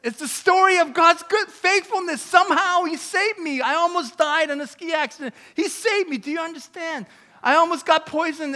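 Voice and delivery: insistent, high preacher's voice; impassioned preaching. Real, not acted. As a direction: drive each point home to the congregation. Preaching at full force.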